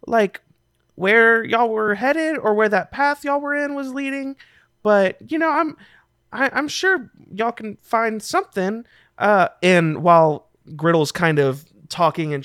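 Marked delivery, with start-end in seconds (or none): 0.1-8.8 put-on high-pitched, hesitant kid voice; kid character. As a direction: answer as an unsure youngster, pitched way up. Game master voices a kid.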